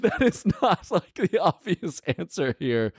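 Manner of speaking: high, giggling protest; laughing disbelief. Real, not acted.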